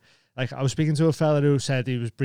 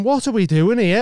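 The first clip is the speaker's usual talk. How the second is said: high, loud retold exasperation; acted incredulous outburst; exasperated disbelief; 8 semitones higher, 8 dB louder than usual. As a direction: challenge a baffling heckler, pitched up. Acted exasperation at a baffling heckler.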